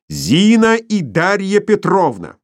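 loud, drawn-out call; yelling. A narrator's reading. Bormenthal yells for the maids, loud. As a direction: bellow for the women. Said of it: "завопил".

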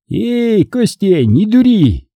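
high-pitched call from the bushes; Абай. A narrator's reading. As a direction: call out a warning to a cornered man. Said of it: "раздался откуда-то из кустов голос Абая".

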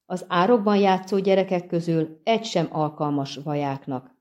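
plain reading voice

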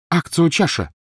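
loud, high spell cry; spell shout. A narrator's reading. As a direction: cast it in desperation. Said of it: "крикнула Гермиона".

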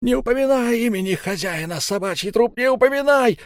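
high, shrill distorted screech; distorted shriek. Acted. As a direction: screech it in a warped voice. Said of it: "искажённым голосом заверещал Эльм".

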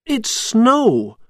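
high, delighted announcement; happy. A narrator's reading. Jack telling the town about snow, high and happy. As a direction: say it happily, pitched well up. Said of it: "Jack said happily".

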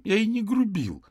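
put-on very high, loud childish protest; high-pitched, indignant denial; indignant, frightened boy. A narrator's reading. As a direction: cry out your innocence, scared. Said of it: "дрожащим от возмущения и страха голосом".

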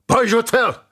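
high, loud battle order; shouted command. A narrator's reading. Szilárd ordering shields up before the dead attack, shouted command. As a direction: shout it to the troops. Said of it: "kiáltotta Szilárd".

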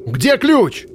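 high urgent shout on ключ. A narrator's reading. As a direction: yell it over the fire. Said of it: "крикнул".

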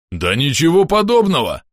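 loud, high indignant protest; yelling. A narrator's reading. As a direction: yell out a flat denial. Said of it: "завопил Бом". (возмущённый вопль)

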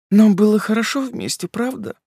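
high, pitiful tearful question; sobbing bride asks her fiancé; pitiful sob. Acted. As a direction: whimper it out, hoping. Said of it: "жалко всхлипнула Полина".

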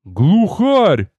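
stunned cry of discovery; astonished blurt. A narrator's reading. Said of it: "Поражённо выпалил тёзка".

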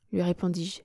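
ordinary delivery, nothing marked

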